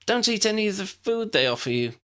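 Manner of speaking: sudden high blurt. A narrator's reading. Lydia warning Ginny and Dennis about the food, blurting. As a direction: it escapes before she can stop it. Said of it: "Lydia blurted out".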